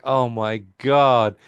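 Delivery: slow drawn-out oh my God; mortified groan. Retold or imitated. Embarrassed friends at the cinema, slow and cringing. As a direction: stretch it out, cringing.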